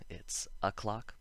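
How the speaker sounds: a clock whispered as two words, uh KLOK, not o'clock; correct a clock. Shown as a demonstration. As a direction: whisper a clock with a clear separate a. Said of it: "Don't say o'clock".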